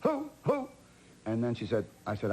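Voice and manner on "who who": sharp high imitation of Hepburn; Hepburn's who. Burt mimicking Hepburn not recognizing his name. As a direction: toss out a high startled who in her voice. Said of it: "I said that four times".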